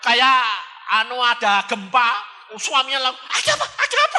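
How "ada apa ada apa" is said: high, startled questions with a laugh. Retold or imitated. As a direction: jump up alarmed. Startled husband asks. (suami kaget bertanya)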